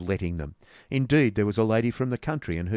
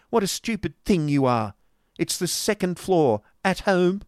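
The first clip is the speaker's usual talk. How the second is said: scolding servant; 10 semitones higher, 6 dB louder than usual. Acted.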